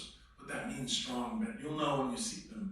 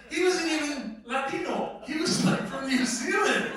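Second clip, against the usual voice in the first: incredulous; 12 semitones higher, 8 dB louder than usual. Real, not acted. Marvelling at the actor's origin.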